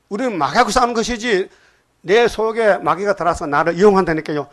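ordinary delivery

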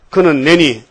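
loud, firm declaration; proclaiming. Acted. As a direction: announce it like a verdict. 예수의 대답 낭독. (크고 단호한 선언)

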